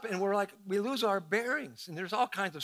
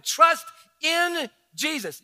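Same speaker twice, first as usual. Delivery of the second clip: loud, higher, slow, stress on Trust; emphatic exhortation; 6 semitones higher, 6 dB louder, slower than usual. Shown as a demonstration. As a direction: drive home the point. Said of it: "emphatic sermon point".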